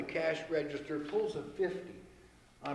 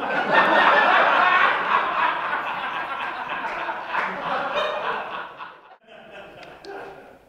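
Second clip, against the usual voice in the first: loud, long real laugh; big laugh; 10 semitones higher, 11 dB louder than usual. Real, not acted. Teller cracking up at his own joke, laughing loud. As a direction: laugh hard at the punchline.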